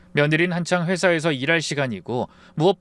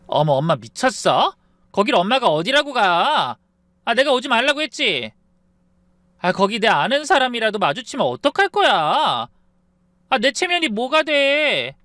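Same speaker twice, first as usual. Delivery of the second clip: shrill high angry yelling at mother, much higher; daughter screaming; 9 semitones higher, 4 dB louder than usual. Acted. Daughter shouting at her mother on the phone, high furious yell. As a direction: yell at mom like she is a disgrace. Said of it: "하고 소리를 지르는데".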